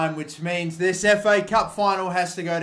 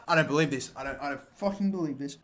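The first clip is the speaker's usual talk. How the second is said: muttered disbelief; 6 semitones lower, 8 dB quieter, faster than usual. Real, not acted. Gamer muttering after the penalty decision.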